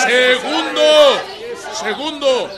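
high, loud proclamation of the next point; ringing announcer call; proclaiming loudly. Acted. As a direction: announce it to the room.

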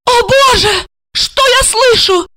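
high-pitched indignant exclamation; outraged cry. A narrator's reading. Wife hearing husband's excuse, outraged cry. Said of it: "закричала она".

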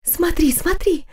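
put-on excited girl, loud stage whisper; too-loud gossiping whisper. A narrator's reading. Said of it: "прошептала одна из них даже слишком громко".

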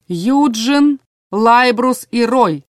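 slow drawn-out names. A narrator's reading. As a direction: stretch the names out slowly. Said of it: "протянул Иррой".

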